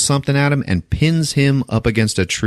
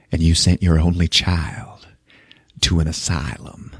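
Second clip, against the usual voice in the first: low whispered taunt, trailing off softer; menacing whisper; 4 semitones lower, 3 dB quieter than usual. Retold or imitated. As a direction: whisper it right into her ear to wound.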